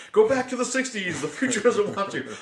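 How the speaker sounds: high and loud, imitating a booming actor's line; mock shouting movie character. Co-host reenacts the movie line for his podcast partner, hammed-up and loud. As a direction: belt the quote out like the character.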